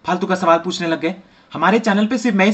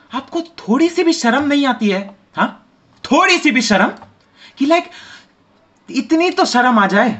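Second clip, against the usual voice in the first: higher-pitched mock scolding; scolding host; 8 semitones higher than usual. News host to Corona, higher mock outrage scolding. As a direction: shame him like an outraged anchor.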